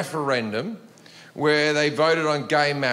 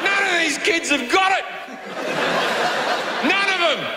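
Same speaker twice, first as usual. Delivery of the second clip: mock outrage; 11 semitones higher than usual. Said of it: "And I'm like".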